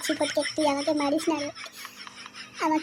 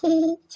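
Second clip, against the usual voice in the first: naughty giggle; 5 semitones higher, 6 dB louder than usual. Acted. Lustful laugh after flirting.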